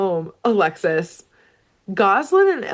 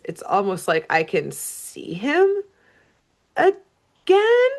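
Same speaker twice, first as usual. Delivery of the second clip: halting, then a high rising squeak; mock startled realisation; 7 semitones higher, slower than usual. Daughter looks up and jumps back, mock startled. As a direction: break off, then lift the last word high. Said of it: "looks up and jumps back and says".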